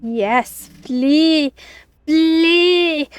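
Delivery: loud, hammy villain; theatrical villain command. Pretend. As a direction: boom it out like a cartoon villain. Mock villain urging the colleague away.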